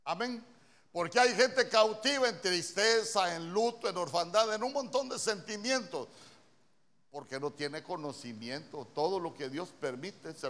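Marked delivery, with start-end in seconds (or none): 0.9-6.1 preaching at full force. Acted. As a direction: preach it out over the congregation. Preacher builds emphasis.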